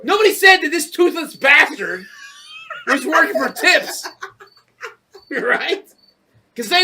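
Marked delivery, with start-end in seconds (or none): 0.0-4.1 higher, louder angry shout. Real, not acted. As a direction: yell it in outrage.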